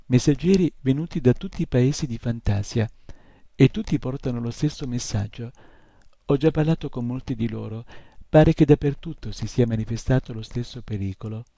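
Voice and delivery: ordinary delivery, nothing marked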